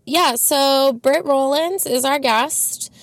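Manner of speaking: ordinary talk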